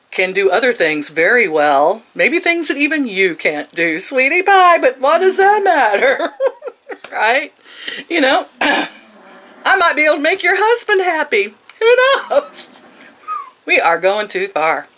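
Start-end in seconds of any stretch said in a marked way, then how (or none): 4.0-12.8 high, sassy mock taunt, laughing; taunting sweetie. Taunting the rude coworker, higher sassy mock voice. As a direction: needle her with a sugary jab.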